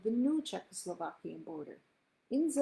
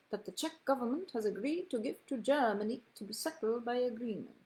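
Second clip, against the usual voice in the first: put-on a British accent, read like a formal radio address; a measured British accent for a wartime statesman; a lightly done British accent on a solemn national broadcast; Chamberlain in British accent; 3 semitones higher than usual. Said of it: "I should do this in a British accent".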